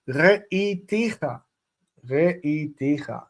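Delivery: re'iticha said slowly, stress on the last syllables; drawn out re'iticha with the first vowel reduced; slow teaching say of re'iticha; stress shift demo. Shown as a demonstration. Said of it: "this causes the word stress to shift".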